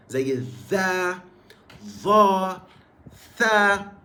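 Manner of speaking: drawn-out ذا، ظا، ثا with the tongue between the teeth; interdental sounds. Shown as a demonstration. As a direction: stick the tongue out for ذا، ظا، ثا. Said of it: "الحروف اللي أنت بتطلع فيها لسانك". (أصوات ذا، ظا، ثا بإخراج اللسان)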